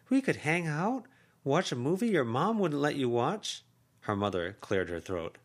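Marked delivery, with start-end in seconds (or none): 0.1-3.6 higher, lively caring aunt voice; voiced aunt, high and urging; effusive aunt. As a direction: coax her sister, pitched up and earnest. Aunt proposes fun night.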